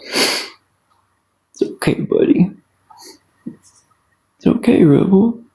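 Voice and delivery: slow, hushed comfort to a sick pet; soothing whisper. Real, not acted. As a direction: whisper it gently and slowly. Soothing her sick dog.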